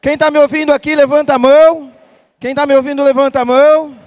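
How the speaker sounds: high energetic crowd call; hype. Real, not acted. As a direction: fire up the audience. Hyping the crowd to raise hands.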